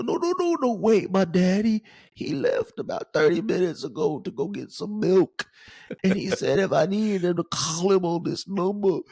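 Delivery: high, put-on child voice; little chubby kid. Prank caller posing as a child looking for daddy, pleading kid voice. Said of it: "little chubby kid".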